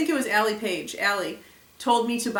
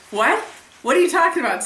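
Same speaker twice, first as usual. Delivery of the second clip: loud, high imitation of a baffled reply; retold startled shout; 7 semitones higher, 8 dB louder, faster than usual. Wife mimicking husband answering her from bed, loud and baffled. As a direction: re-enact a confused shout back.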